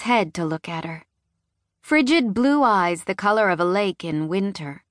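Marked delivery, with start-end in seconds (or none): none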